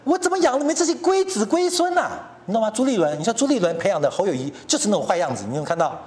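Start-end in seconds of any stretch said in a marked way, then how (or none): 0.1-2.1 put-on high, exasperated old statesman's cry; mock exasperated elder. Chiang Ching-kuo scolding his heirs, high exasperated wail. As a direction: lament like a disappointed father, pitched up. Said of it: "连蒋经国都说：".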